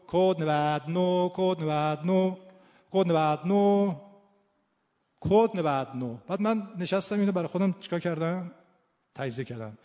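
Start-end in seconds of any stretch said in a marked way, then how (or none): 0.1-6.2 loud, drawn-out Kermani announcer chant; Kermani accent. Retold or imitated. Hospital operator paging code ninety-nine, Kermani accent. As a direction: imitate a Kermani operator, stretching the vowels. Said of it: "تلفنچیمون کرمونی بود و همون لهجه رو داشتش".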